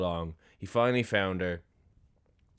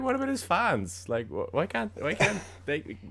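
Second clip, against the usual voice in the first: way higher, squeaky indignant disbelief; mock outraged disbelief; 11 semitones higher than usual. Telling a friend how baffled she was, squeaky disbelief. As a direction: squeal it like you can't believe it. Retelling own baffled reaction.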